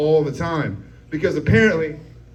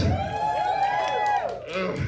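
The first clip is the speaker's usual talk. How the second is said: disgusted groan; 5 dB quieter than usual. Real, not acted. Reacting to the ladies' cheer.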